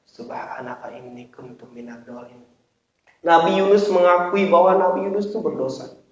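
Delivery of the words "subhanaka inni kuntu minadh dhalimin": low, hushed recitation; quiet prayer. Acted. Preacher recites Yunus's prayer from the whale, hushed. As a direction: recite softly and humbly, dropping away.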